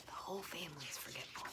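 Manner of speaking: a soft, breathy whispered comment; whispered aside. Real, not acted. Reader whispering an aside about the forgetful family. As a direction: whisper it to the listener. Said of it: "reader's whispered aside".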